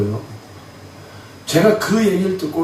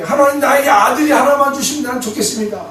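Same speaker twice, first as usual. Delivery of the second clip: high, loud, earnest plea to God; a woman begging God for a son, high and loud; Hannah praying; 9 semitones higher, 4 dB louder than usual. Retold or imitated. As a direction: Hannah pouring out her wish, voice raised high. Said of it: "한나에게".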